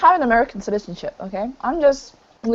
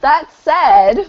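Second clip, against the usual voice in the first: laughing; 5 semitones higher, 3 dB louder than usual. Real, not acted. Repeating partner's cue, laughing.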